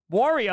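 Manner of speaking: Wario said with aw, waw-rio. Shown as a demonstration. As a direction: say Wario like war-io. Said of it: "It's not Wario".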